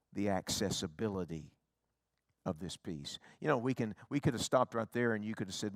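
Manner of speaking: usual speaking voice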